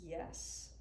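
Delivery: usual speaking voice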